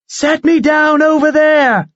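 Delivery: high, loud command; shout. Acted. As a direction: call out to be set down. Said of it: "she shouted".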